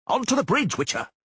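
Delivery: high, loud battlefield command; shouted order. Acted. Doragore orders the Witcher onto the bridge, loud high shout. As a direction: yell the way to safety.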